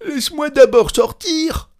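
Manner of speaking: high, loud plea; panicked cry. A narrator's reading. Cantor begging to be let out, high panicked cry. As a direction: beg urgently in a squealed shout. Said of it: "s'écria le chantre dans le coffre".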